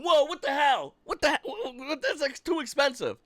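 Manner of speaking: high, far-off outraged shopper; mock outrage. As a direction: ham up shock at the price. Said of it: "Everyone's going".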